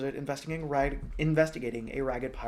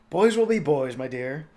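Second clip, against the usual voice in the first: put-on mild jolly old king; 4 semitones higher, 8 dB louder than usual. King speaking kindly.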